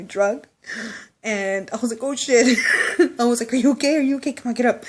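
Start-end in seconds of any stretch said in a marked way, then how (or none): 2.0-3.2 loud, high startled curse. Retold or imitated. Friend falls out of truck. 3.5-4.8 loud, high, anxious checking; worried calling. Retold or imitated. Friend fell out of truck.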